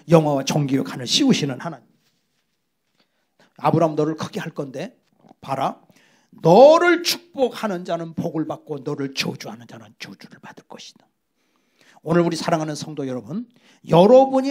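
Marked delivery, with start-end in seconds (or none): 3.6-11.1 low, hushed voice of God promising; God's voice. Acted. God speaking to Abraham, voiced.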